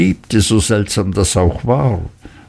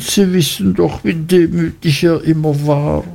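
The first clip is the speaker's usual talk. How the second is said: high, fawning plea; high-voiced Mrs Heep pleading with her son and the gentlemen; pleading mother; 7 semitones higher than usual. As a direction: beg them with humble servility. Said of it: "rief die Mutter".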